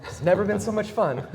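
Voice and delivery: high laughing quip; tossing off a joke, then laughing. Real, not acted. Joking reply then laughing.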